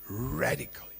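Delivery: soft unvoiced whisper; hushed aside; whispered echo. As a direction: breathe the word out as an echo.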